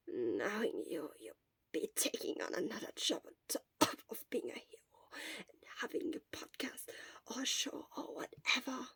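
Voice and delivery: a weak teasing whisper; hoarse mocking whisper. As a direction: tease him with what little voice is left. Sick Shota whispers teasingly.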